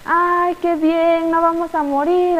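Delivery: lightly acted delight from the relieved townspeople; relieved joy. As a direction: cheer like townsfolk who were just spared. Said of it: "la gente estaba muy feliz".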